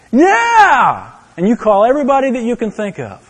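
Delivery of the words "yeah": high, loud burst of joy; thrilled parental yell; delighted cheer. Retold or imitated. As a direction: cheer like the baby just said daddy. Parent hears child say daddy.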